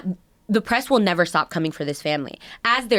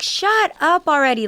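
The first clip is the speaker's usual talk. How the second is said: mock snippy Bethany, higher and slowed; imitating Bethany; 7 semitones higher than usual. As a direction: toss off a dismissive shut up as her, slowly. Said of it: "she was like".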